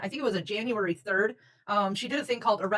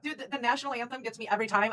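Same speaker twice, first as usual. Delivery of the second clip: ordinary talk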